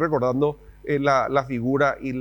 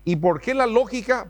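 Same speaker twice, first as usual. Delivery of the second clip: normal talking voice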